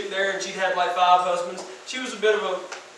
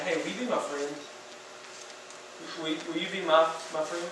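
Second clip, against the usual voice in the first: softer, lower gentle plea; soft tender ask; 4 semitones lower, 9 dB quieter than usual. Acted. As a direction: ask quietly, like offering friendship. Jesus gently asking friendship.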